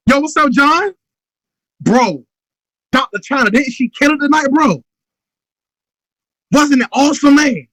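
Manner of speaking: excited follow-up call. Shown as a demonstration. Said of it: "I'm calling them".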